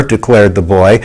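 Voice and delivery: ordinary delivery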